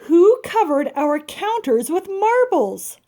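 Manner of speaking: slow, high, loud outrage; outraged demand. Acted. Mr Hardy demands who covered the counters, slow and loud. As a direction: bellow it at the marbled counters.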